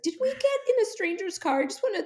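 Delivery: very high, incredulous replay; shocked disbelief. Retold or imitated. Retelling waking up after riding with a stranger, aghast. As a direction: blurt it out in hammed-up alarm.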